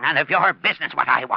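loud and fast, snapping back; a cornered miser lashing out; angry retort. Acted. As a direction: bite it off in anger. Miser snaps back at the Shadow.

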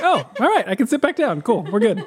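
high, lilting mock-casual relief; sudden relief. Reacting to Katie's reply on the plane, comically relieved. Retelling relief after text arrived.

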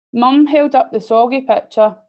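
usual speaking voice

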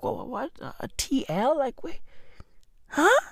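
quietly bewildered, questioning; baffled reaction. Retold or imitated. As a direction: stammer softly, trying to work it out. Acts out his baffled reply to wife.

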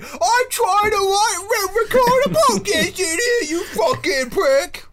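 furious, high-pitched British shout; angry British. Acted. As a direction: yell it in an outraged British accent, voice pushed way up. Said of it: "you suddenly are fucking British".

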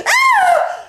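high startled shriek with a laugh. Retold or imitated. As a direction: shriek in disgust. Woman jumps back in disgust.

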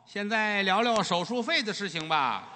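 mock doctor. Doctor abruptly raises surgery fees.

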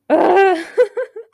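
loud, high burst of real laughter; overwhelmed laugh. Real, not acted. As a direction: laugh it off while getting emotional. Overcome by emotion, laughing it off.

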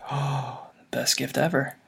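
soft awed whisper; hushed gasp of delight. Real, not acted. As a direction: breathe it out, touched. Moved reaction to photos.

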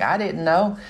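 put-on high kid voice, rising, protesting; innocent kid. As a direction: whine like a kid caught out. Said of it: "they're like".

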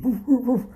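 high imitated woofs; dog barking. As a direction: bark like an excited dog. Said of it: "the dog starts barking".

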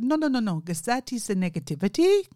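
imitated Indian accent, lifting at the end. Caller objecting to her suspicion, Indian accent. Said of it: "he has a very strong Indian accent".

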